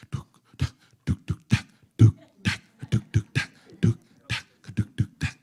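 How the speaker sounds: unvoiced clicking tuk tak like a pounding heart; heartbeat sounds. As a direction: tap out a racing heartbeat with the mouth. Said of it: "your heart is kinda, like, pounding".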